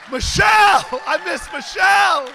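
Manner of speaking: loud, laughing call to Michelle; playful loud declaration; joyful shout-out. Real, not acted. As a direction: shout it to the rafters, beaming. Crowd-pleasing shout-out to Michelle.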